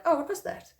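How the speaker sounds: high, startled "Oh, what was that?"; surprised. Pretend. A walker startled as birds suddenly fly off. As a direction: jump at something odd.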